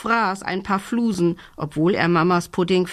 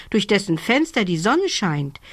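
ordinary delivery, nothing marked

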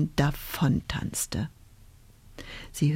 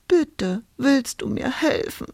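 higher, pleading, lightly sobbing; 7 semitones higher than usual. A narrator's reading. Lost witch begs the owl, a touch of sobbing. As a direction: ask through mild tears. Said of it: "schluchzte Hulda".

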